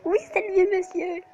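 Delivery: high, loud delighted Oui; excited cheer. Real, not acted. Player celebrating the old man's arrival, excited. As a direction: cheer the rescuer.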